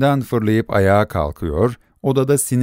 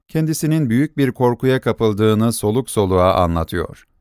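ordinary delivery

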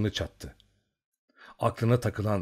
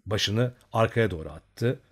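usual speaking voice

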